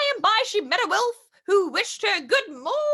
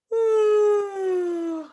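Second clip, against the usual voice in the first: sleepy yawn sigh. Acted.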